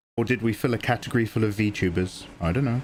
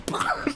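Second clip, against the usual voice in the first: high burst of laughter. Real, not acted.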